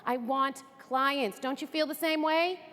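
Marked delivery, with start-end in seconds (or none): none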